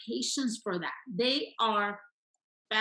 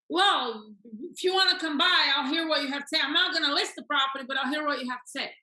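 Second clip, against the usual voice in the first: louder, higher, hurried concession; gruff conceding seller; 4 semitones higher, 5 dB louder, faster than usual. Acted. Coach playing seller who may accept appointment, insistent. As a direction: push back while grudgingly agreeing.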